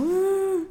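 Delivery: high imitated crying wail; mock sob. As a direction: act out the crying. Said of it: "yo llorando así como". (sollozo agudo imitado)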